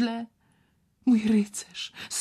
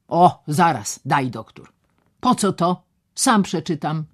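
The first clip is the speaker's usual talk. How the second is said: brusque doctor; 8 dB louder than usual. Acted. Doctor teases patient.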